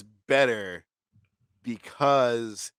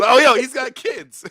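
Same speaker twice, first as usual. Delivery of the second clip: high, loud, drawn-out mock realization; exaggerated outburst in a quote; mock excited exclamation; 11 semitones higher, 8 dB louder, slower than usual. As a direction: call it out high and slow, sending it up. Acting out excited movie reaction.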